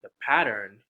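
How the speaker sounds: usual speaking voice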